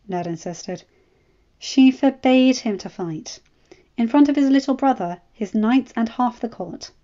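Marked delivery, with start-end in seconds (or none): 1.6-6.9 pointing out. A narrator's reading. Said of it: "Ella pointed out".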